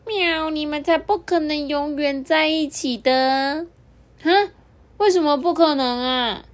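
cat correcting the button. Acted. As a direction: purr it out like a smug cat. Cat voice with meows.

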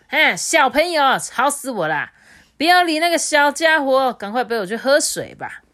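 high, loud, imperious rabbit; stately rabbit. A narrator's reading. Rabbit on the deer's back dismissing the tiger, high imperious voice. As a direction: command like a grand old ancestor. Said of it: "用威严的口气这样讲".

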